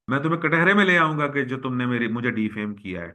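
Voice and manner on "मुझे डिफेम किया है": normal talking voice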